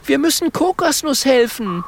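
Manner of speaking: high-pitched, urgent little character voice; desperate porcupine. Acted. Mathilda urges rescuing Kokosnuss, desperate. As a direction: plead for a friend in a high voice. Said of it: "fragt Mathilda verzweifelt".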